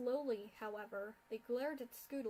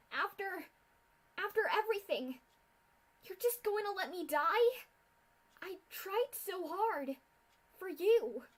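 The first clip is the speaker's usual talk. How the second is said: broken, tearful cry, higher; tearful pleading; 8 semitones higher, 8 dB louder than usual. A narrator's reading. Filly pleading through tears with her mentor. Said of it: "Her throat hurt from the crying and shouting".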